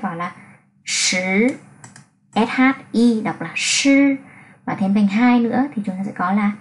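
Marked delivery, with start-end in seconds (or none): 2.3-4.2 "sư" said slowly with lips pushed out and tongue curled; careful demo. Shown as a demonstration. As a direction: show the sound "sư" slowly. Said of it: "chúng ta sẽ đọc là sư". (giáo viên đọc chậm "sư")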